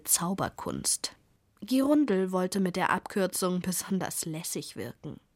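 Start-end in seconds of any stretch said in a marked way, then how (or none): none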